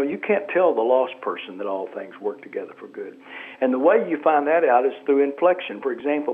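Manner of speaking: ordinary talk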